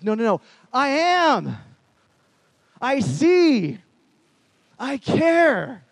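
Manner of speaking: loud, high, ringing assertions; emphatic declaration. Retold or imitated. God answering that he sees and cares, emphatic shout. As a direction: proclaim each short phrase with full force.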